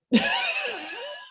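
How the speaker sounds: high whooping laugh; sudden high laugh that breaks out. Real, not acted. Laughing after bold statement.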